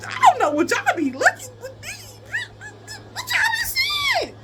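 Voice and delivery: can't believe what they see in him; incredulous. Real, not acted. Incredulous at the girls.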